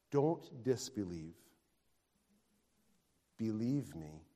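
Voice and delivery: ordinary talk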